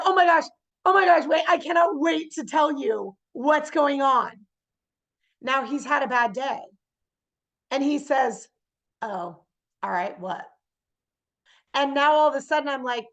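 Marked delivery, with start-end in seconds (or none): 0.0-4.3 higher, louder gush of good news; bubbling over, can't wait to share; excited. Acted. Acting out excited call to husband. 9.0-10.4 low, softer, dejected reply; weary, unenthusiastic husband; deflated. Acted. Plays deflated husband answering.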